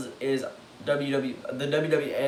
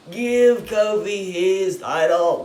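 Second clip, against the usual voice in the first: loud, slow, higher demand; retold scream; 6 semitones higher, 9 dB louder, much slower than usual. Fan yelling at WWE for Kofi's title, retold loud demand. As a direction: hammer out each word like a chant. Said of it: "I'm screaming in my head".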